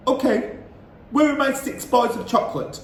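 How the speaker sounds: pushy schoolboy wanting his chocolate; cheeky boy demands his sweets; Bruno's voice. A narrator's reading.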